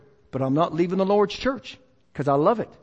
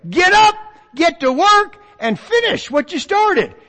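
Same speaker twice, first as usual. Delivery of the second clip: higher, slow and louder call to action; rousing command; 9 semitones higher, 4 dB louder than usual. Acted. Preacher echoes Haggai's call to the church, rousing. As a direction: drive each word home like a prophet. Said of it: "what he's saying to Queenway".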